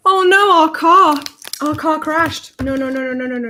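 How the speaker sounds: sudden dismay at the toy car crashing; surprised alarm. Real, not acted.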